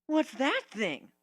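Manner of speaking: put-on high, slow, curious kid voice; young Spike. A narrator's reading. Spike curious about the griffin's dragon-scale sword. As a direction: ask it like a wide-eyed little dragon.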